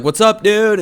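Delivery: mock shout across the street, high and loud; retold loud, high-pitched holler; calling out. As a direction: call a friendly greeting to someone far off. Retold greeting called across the street.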